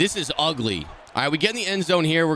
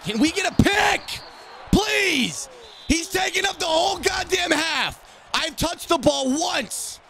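very high, drawn-out furious yelling; exasperated high-pitched rant; gamer rage; 13 semitones higher, slower than usual. Real, not acted. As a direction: wail your outrage, stretching the words. Rage at opponent in game.

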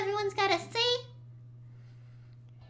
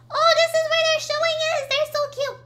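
gushing; 6 semitones higher, 5 dB louder than usual. Real, not acted. Gushing at art display.

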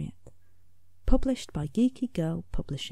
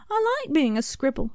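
Scribble shouting; 8 semitones higher, 4 dB louder than usual. A narrator's reading. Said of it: "shouted Scribble".

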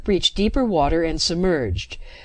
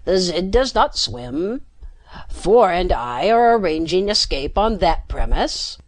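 alien croak. A narrator's reading. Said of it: "the Cyrimian croaked".

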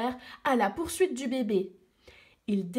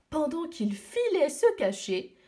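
ordinary delivery

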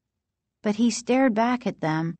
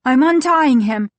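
higher, louder declaration; raised, determined voice; defiant announcement; 5 semitones higher, 7 dB louder than usual. A narrator's reading. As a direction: announce it loudly, high and firm. Defiant child announcing action.

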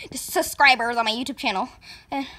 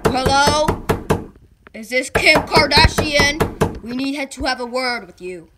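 cop voice; 7 dB louder, slower than usual. Acted. Cop at the door.

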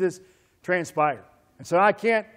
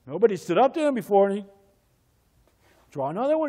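ordinary talk

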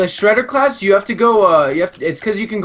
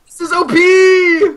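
high, loud, drawn-out yell; shouted complaint; 10 semitones higher, 5 dB louder, slower than usual. Real, not acted. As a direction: holler it in protest. Complains mid-fight.

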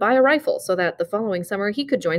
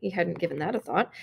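ordinary delivery

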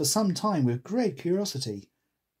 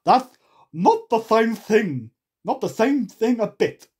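loud, slow, high eccentric; madcap, drawn-out objection; Hatter; 7 semitones higher, 7 dB louder than usual. A narrator's reading. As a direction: contradict forcefully in a put-on voice. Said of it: "said the Hatter".

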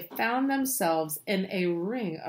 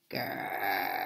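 long, low growl; horrible tiger growl; 12 semitones lower, 7 dB quieter than usual. A narrator's reading. Distant tigers heard by Rajani, low drawn-out growl. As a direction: growl low and keep it rolling. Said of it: "he heard a horrible noise that sounded like".

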